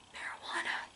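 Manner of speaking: whispered secretly; a conspiratorial whisper. Pretend. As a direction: mouth the word in a hush. Hushed word for drug on camera.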